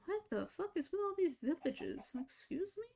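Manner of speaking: high, soft baffled disbelief; genuinely thrown by the villages; incredulous. Real, not acted. Gamer baffled at another village, high and soft.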